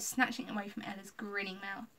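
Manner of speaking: usual speaking voice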